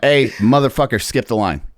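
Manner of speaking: a mock yell at a line cutter; mock challenge. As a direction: call him out for skipping.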